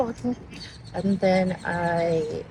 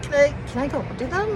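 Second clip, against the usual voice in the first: higher, fast and eager; excited asking; 10 semitones higher, 4 dB louder, faster than usual. Real, not acted. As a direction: rush the question out giddily. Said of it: "I'm freaking out".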